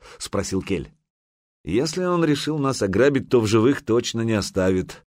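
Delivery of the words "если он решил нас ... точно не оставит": ordinary delivery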